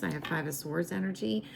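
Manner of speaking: ordinary talk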